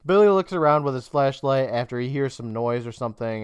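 usual speaking voice

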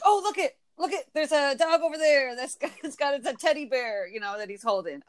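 acted excitement at a sight; excited pointing-out. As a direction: point it out eagerly, pitch up. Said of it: "But I'll be like".

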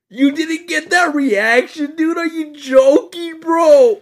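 high, loud and slow, still reeling from the burn; disbelieving shout. Real, not acted. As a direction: yell it out in pained disbelief. Disbelief after the sniff.

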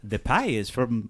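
a laughing, half-hearted Irish accent; Irish accent breaking into laughter; Irish accent attempt. Shown as a demonstration. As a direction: try an Irish lilt and crack up. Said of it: "here's my attempt to do the mom's accent".

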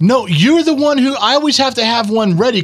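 high-pitched indignant objection; exasperated protest. Real, not acted. Mock-indignant protest at co-host.

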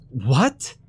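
high, startled cry; mild shrieked what; shocked shriek. A narrator's reading. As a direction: jolt out the word in alarm. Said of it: "Amber shrieked".

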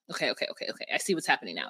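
soft, whispered okays; dawning realization under the breath; hushed realization. Retold or imitated.

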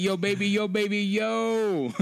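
put-on high, slow drawn-out yo baby; cheesy pickup call. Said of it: "I'd be like".

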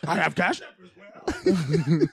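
slow, gruff puppet monster voice through laughter; Cookie Monster voice. Pretend. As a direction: drag it out like a cookie-hungry monster. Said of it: "still in Cookie Monster voice".

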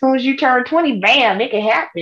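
lying doctor. Retold or imitated. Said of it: "have a doctor come out and lie and say".

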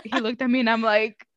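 ordinary talk

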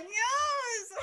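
very high, drawn-out squeal; gleeful squeal. Retold or imitated. Retelling delight at friend's struggle, squealing. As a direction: squeal it with laughter in the voice. Gleeful reenactment of own reaction.

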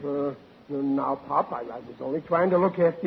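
low, subdued stammer; meek excuse. Acted. Son explains himself to his stern father, meek excuse. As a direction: plead softly and sheepishly.

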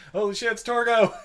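excited exclamation. Retold or imitated. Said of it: "and I'm like".

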